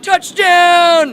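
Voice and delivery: loud high cheer. Real, not acted. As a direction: cheer it like a touchdown. Celebrating a shot.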